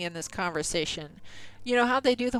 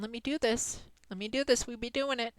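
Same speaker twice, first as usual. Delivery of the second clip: ordinary delivery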